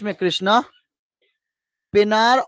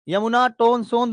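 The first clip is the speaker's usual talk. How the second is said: ordinary delivery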